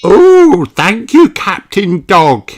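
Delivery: high, loud delighted chorus; everyone thanks. A narrator's reading. Family thanking Captain Dog for presents, high delighted voices. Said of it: "everyone says".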